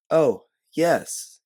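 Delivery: high, loud Southern belle reply; showy Southern-accented assent; best Southern accent. A narrator's reading. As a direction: put on a Southern accent brightly. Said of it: "throwing on your best Southern accent".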